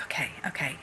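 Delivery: soft unvoiced whisper, stunned; whispered okay. Repeating okay to her reassuring husband, whispered. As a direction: let the okays fall to a whisper.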